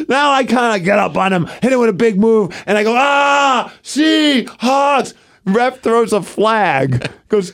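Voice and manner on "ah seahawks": high, drawn-out victory holler; taunting crowd call. Retold or imitated. Wrestler taunting after a big move, high holler.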